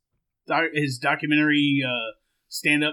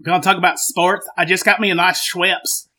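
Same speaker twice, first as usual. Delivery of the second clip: put-on Southern accent. Co-host chats about his ginger ale, Southern accent. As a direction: ramble like a comic Southern good old boy. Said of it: "to change accents".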